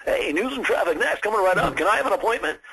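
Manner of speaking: mock the over-the-top DJ hype; radio DJ. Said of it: "do I think you need to be a radio D J".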